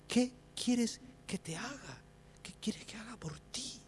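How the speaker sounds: high-pitched, slow question that softens; tender offer. Acted. Jesus asking the blind man what he wants, tender and high. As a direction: ask it slowly and high, fading to softness.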